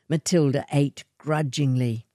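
ordinary delivery

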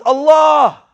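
loud, high cry of Allah; imitating a friend crying out mid-crash; retold scream. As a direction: cry out Allah in sudden panic. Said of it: "he screams".

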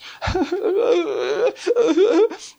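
high mock sobs; imitated weeping. Preacher showing how one child wept, mock sobbing. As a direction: imitate a child bursting into tears. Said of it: "just weeping, just".

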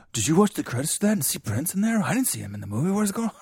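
high, breathless movie-rumour gossip; high-pitched mock astonishment; excited fan chatter. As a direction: gush like a baffled fan. Voices excited rumour-spreading film fans.

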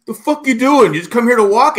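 high, loud heckle from a teammate; retold yelling. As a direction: yell it indignantly across the field, imitating him. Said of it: "fucking yelling at me".

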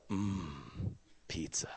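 low, soft, savoring the word; like tasting it already; savoring mmm. Retold or imitated. Savoring food choice.

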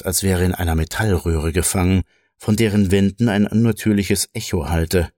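ordinary delivery, nothing marked

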